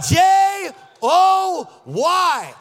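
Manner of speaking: loud, very slow spelled-out cheer; okrzyk do tłumu. Real, not acted. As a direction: shout each letter separately, drawn out. Leads congregation spelling joy.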